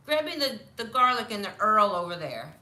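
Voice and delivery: loud Brooklyn dad impression; Brooklyn accent. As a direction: call out like a Brooklyn father, "oil" as "Earl". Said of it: "my father talked like he was from Brooklyn".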